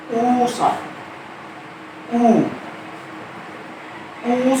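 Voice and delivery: rounded ऊ held long; long oo sound. Shown as a demonstration. Teacher modelling the long ऊ vowel for students. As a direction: draw out the ऊ. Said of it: "आगे हम लोग चलें तो".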